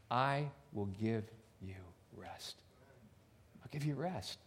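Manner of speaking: soft, slow, falling to a hush on rest; tender promise. Acted. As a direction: lay the promise down gently and slowly. Jesus voiced, promising rest.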